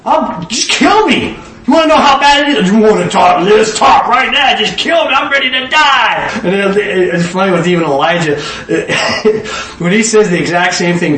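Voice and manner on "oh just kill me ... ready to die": venting despair. Acted. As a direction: blurt it out like a man begging to die. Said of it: "After Elijah vented".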